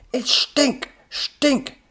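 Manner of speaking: very high, loud outburst; furious scream. A narrator's reading. Professor correcting robot's mistake, furious scream. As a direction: scream the name with a sh.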